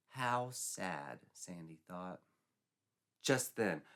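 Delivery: plain reading voice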